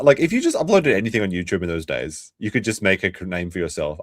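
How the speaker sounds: usual speaking voice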